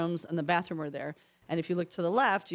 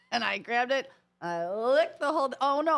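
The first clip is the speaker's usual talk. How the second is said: ordinary delivery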